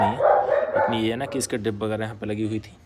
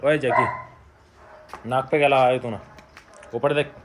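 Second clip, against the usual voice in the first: loud, raised telling-off; scolding a dog; 3 semitones higher, 7 dB louder than usual. Real, not acted. Owner to Jackie about his dirty nose, scolding. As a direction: call out sharply to question the dog.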